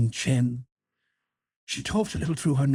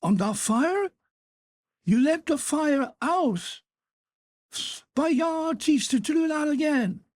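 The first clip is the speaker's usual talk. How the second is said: put-on much higher outraged shout at the boy; furious. Father rages at his son over the dead fire, high outrage. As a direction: rage at the child for letting the fire die. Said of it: "The man jumped up furiously".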